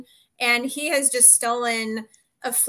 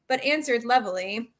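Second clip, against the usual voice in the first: ordinary delivery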